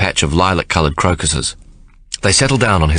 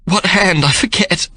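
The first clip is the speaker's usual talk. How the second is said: much higher, rushed and fretful; flustered panic; 13 semitones higher, slower than usual. A narrator's reading. Sarah flustered about which hand, panicked. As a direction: fluster over the simplest thing. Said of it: "cries in a sudden panic".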